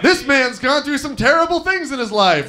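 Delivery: ordinary talk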